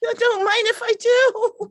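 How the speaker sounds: high, loud and eager; eager squeal. Acted. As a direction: jump at the chance gleefully. Becky eagerly accepts touching.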